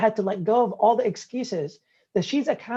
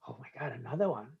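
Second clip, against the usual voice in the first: muttered exasperation; 8 semitones lower, 10 dB quieter, faster than usual. Retold or imitated. Exasperated aside, retold.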